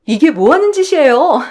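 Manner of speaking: high, loud cry of outrage from an employee; startled, indignant outcry; shocked protest. Acted. Employee steps into the assault.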